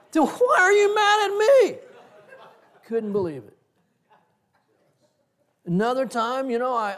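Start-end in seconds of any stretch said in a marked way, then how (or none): 0.1-1.8 high, loud, indignant mock yell; retold protest, pitched way up and loud; incredulous outburst. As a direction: throw the question back in exasperated disbelief. Retelling his indignant comeback to someone.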